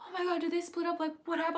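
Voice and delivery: high panicked gasping question. Retold or imitated. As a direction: panic as if news broke. Retold panic.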